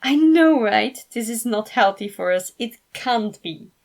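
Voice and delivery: giddy fan overwhelmed with delight; excited exclaim. Acted. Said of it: "Felix exclaimed".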